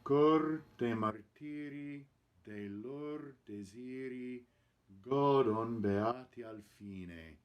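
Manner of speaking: slow, clear Italian; model recitation. Shown as a demonstration. Coach reading the final line to the choir, slow clear model. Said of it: "in the final line".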